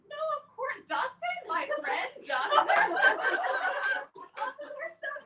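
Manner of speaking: a high, giggling, flustered no; flustered laughing denial. Retold or imitated. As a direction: deny it through laughter, caught out. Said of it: "so I was like".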